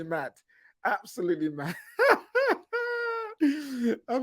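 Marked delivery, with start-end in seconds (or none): 2.0-4.0 squealing high laugh; cracking up at the rant. Real, not acted.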